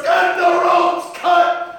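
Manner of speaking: a captain shouting over cannon fire; shouted order. Acted. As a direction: yell the order across the deck. Officer shouting order mid-battle.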